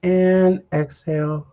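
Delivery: guided breathing. Acted. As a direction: lead the breath gently, unhurried. Leads a breathing meditation.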